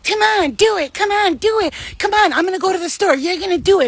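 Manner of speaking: imitating high, insistent peer pressure; pushy urging. Girl pressuring her friend to hook up, pushy and high.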